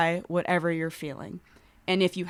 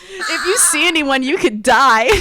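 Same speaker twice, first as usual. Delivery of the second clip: mock ominous warning; 8 semitones higher, 11 dB louder than usual. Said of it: "covid was like".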